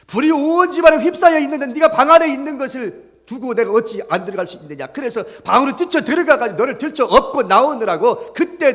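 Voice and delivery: high, loud, urgent mother recalling the fire; impassioned retelling. Acted. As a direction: pour it out with mounting urgency. Mother recounts rushing into the fire.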